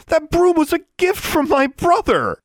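high-pitched outraged yelling; angry shout. A narrator's reading. Girl shouting at the witch over her broom, furious. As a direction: yell it in wounded fury. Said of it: "she shouted".

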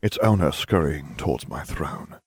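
ordinary delivery, nothing marked

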